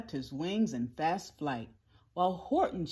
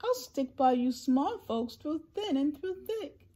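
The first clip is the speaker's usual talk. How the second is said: higher-pitched, earnest promise; raised, reassuring Horton voice; loyal elephant; 7 semitones higher than usual. A narrator's reading. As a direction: pledge loyalty to small friends. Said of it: "Horton answered".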